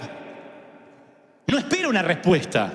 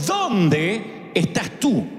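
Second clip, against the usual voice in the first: tender divine call; much slower than usual. Acted. God calling Adam, very slow.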